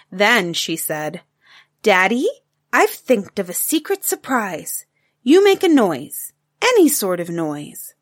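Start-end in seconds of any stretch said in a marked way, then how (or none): 1.8-7.9 lightly voiced excited little girl, higher; a bright child's eager question; a hint of a young girl's voice; little girl Taffy.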